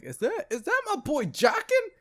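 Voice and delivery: high incredulous rise; shocked fan reaction; astonished. Retold or imitated. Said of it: "I was like".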